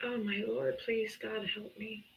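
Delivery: hushed, weary plea; quiet despair. Real, not acted. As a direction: sigh it out under breath. Despairing aside.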